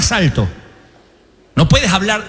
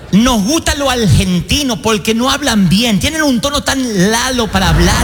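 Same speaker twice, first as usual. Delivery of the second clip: put-on Puerto Rican accent; 3 semitones higher than usual. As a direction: imitate a Puerto Rican, softening R into L. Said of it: "Cuando fui a Puerto Rico".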